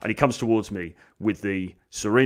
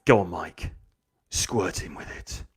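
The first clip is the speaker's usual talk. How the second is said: low, hushed goading; sinister egging on; 6 semitones lower, 6 dB quieter than usual. Acted. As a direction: urge the bully on in a low, secretive voice. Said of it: "she's like".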